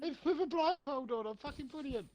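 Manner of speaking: silly high-pitched put-on voice; squeaky voice. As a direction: squeak it like a tiny cartoon man. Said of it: "sound like the squeaky guy".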